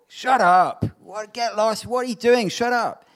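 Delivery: loud, high, hostile shouting; mock crowd yelling at a beggar; angry outburst. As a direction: bark at him to shut up. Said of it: "So that's formal code for".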